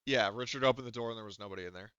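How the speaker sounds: ordinary talk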